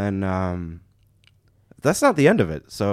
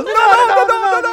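high, loud no no no through laughter; laughing panic yell; 11 dB louder than usual. Retold or imitated. Scammer driving off, yelling high and loud while the teller laughs. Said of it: "his arm is still".

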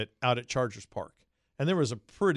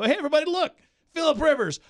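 much higher, louder MC shout; hype announcer; 7 dB louder, faster than usual. Retold or imitated. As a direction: work the crowd into a frenzy. MC hyping crowd on mic.

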